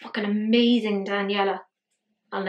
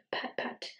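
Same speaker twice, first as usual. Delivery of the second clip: whispered pat; 12 dB quieter than usual. Real, not acted. Gently patting makeup on.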